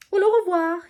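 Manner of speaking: put-on high, loud French-accented farewell. A narrator's reading. His lordship taking leave of his pupil, French accent. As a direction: sing out the goodbye with a French accent. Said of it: "with a good French accent".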